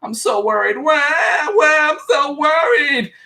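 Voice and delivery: high, rising whiny parody ending in a fake wail; mock whining. Mocking Christy's worry to viewers with a hammed-up high wail. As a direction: ham up her fake worry into a wah. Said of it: "She's like".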